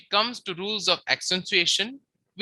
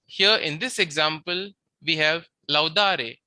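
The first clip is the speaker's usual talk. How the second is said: usual speaking voice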